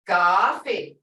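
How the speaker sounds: "Kafe" with a long loud "ah" first; long vowel. Shown as a demonstration. Teacher showing how sound helps spelling, long vowel. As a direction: hold the "ah" in "Kafe". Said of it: "it's long".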